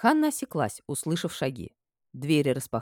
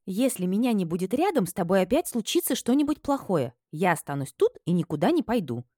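put-on higher, bright young maid; insistent, worried young woman; Hanna's voice; 5 semitones higher than usual. A narrator's reading. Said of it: "заявила она".

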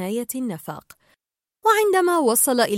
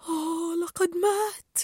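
slow, higher-pitched moan of grief; mournful groan; 6 semitones higher, 4 dB quieter, slower than usual. A narrator's reading. Tank group mourns Nemo as dead, slow mournful groan. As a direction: groan it out slowly. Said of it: "فتأوهت الجماعة".

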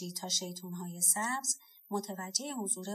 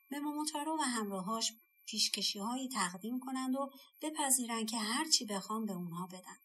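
ordinary delivery

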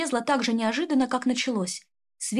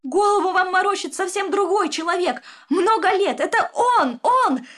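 much higher, louder desperate cry; breaking into a scream; 9 semitones higher, 6 dB louder than usual. Acted. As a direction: scream the accusation at him. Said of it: "сорвалась на крик Ольга". (срываясь на крик)

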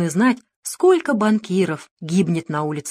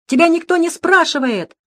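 a much higher, louder raging yell; 12 semitones higher, 6 dB louder than usual. A narrator's reading. Director raging at the woman, loud fury. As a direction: rage at her, full blast. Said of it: "бушевал он".

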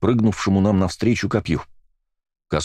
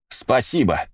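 gleeful and gloating; 5 semitones higher, slower than usual. Acted. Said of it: "злорадно и радостно".

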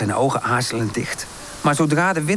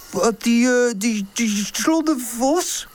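slow, higher, stumbling scared stammer; stammering fright; 9 semitones higher, slower than usual. Acted. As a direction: stutter it out, a little frightened.

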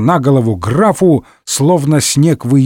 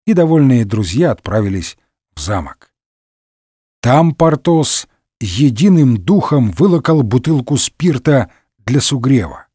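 plain reading voice

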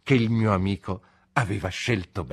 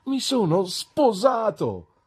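very high, slow, stretched-out exclamation; jubilant announcement; 12 semitones higher, 3 dB louder, slower than usual. A narrator's reading. Traddles tells his old friend his news, jubilant. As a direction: proclaim great news, savouring it.

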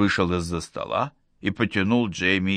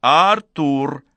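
raised, higher-pitched shout of a name; calling out; 6 semitones higher, 5 dB louder than usual. A narrator's reading. Jamie calls Arthur over from the corridor, shouting. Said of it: "набрала воздуху и закричала".

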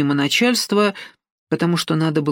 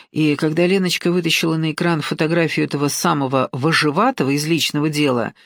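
usual speaking voice

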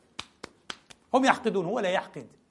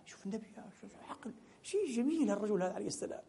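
much softer, marveling murmur; awed hushed aside; 3 semitones higher, 12 dB quieter, faster than usual. Real, not acted. Preacher marveling to the congregation at the Prophet's wisdom, hushed. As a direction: drop to a hush in admiration. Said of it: "awed aside about the Prophet".

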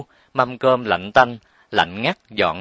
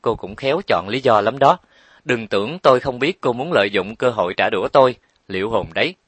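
ordinary delivery, nothing marked

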